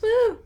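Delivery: high, quick whoop; celebratory woo. Pretend. Celebrating an audition with a made-up dance, whooping.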